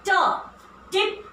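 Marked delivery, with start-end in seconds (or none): 0.1-0.2 ट said as a crisp retroflex t; t sound. Shown as a demonstration. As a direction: model the letter sound ट. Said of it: "sound है ट".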